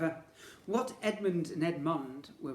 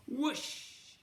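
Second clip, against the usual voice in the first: whoosh; 10 semitones higher than usual. Acted. Rocking horse hurls riders off.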